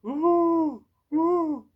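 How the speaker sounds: high cooing pigeon imitation. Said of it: "she sounds a bit like a pigeon".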